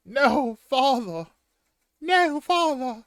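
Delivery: very high, loud British wail; British child. Acted. British boy watching his father attacked, shrieking protest. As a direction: cry out as a frightened British boy. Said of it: "he's British now".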